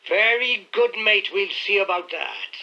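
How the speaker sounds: put-on the drunken butler threatens Victor; menacing threat. A narrator's reading. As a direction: promise trouble, slowly.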